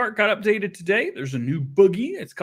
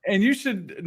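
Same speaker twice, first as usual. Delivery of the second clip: normal talking voice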